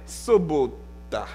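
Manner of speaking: sobota said slowly, the Polish way; Polish word. Shown as a demonstration. Preacher teaching the Polish word, slowly. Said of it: "Et puis en polonais".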